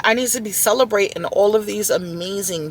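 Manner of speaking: normal talking voice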